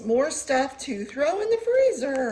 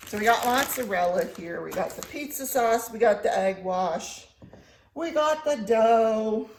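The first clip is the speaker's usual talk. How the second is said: normal talking voice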